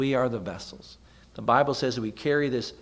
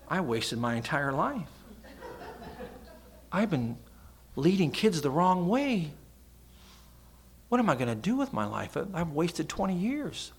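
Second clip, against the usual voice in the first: mock despair; 5 semitones higher than usual.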